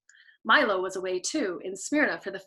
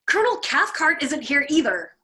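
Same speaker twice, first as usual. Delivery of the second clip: Clevenger's voice; 4 semitones higher, 5 dB louder than usual. A narrator's reading. Clevenger's voice in argument.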